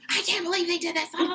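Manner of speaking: hammed-up disbelief; mock indignation. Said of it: "a really strong reaction".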